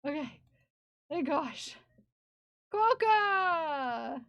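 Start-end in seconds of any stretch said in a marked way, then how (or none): 2.7-4.2 sudden delighted cry; excited shout. Real, not acted. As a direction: call it out with glee. Excited at spotting a quokka.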